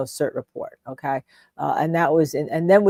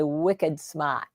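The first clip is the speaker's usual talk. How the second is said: Boston "wicked smart" with dropped R; Boston accent; 6 dB quieter than usual. Retold or imitated. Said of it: "I do like the way they say".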